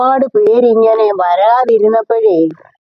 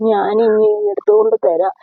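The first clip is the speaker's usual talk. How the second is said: normal talking voice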